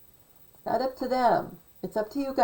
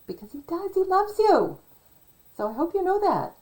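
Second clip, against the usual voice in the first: high, loud, earnest gushing; 10 semitones higher, 5 dB louder, faster than usual. Real, not acted. To listeners, gushing that JFK loves them, high and loud. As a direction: swoon over how much he loves you. Sincere gushing about JFK's love.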